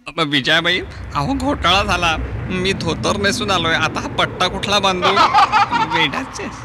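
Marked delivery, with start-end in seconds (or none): 5.1-5.9 high giggle. Acted. Comic high laugh.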